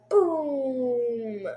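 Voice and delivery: long drawn-out boom, falling; imitated explosion. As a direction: draw out the crash sound. Imitates the crash landing.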